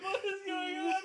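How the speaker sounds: high, loud wail mixed with laughter; bewildered and tearful, hammed up; mock crying. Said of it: "I'm crying".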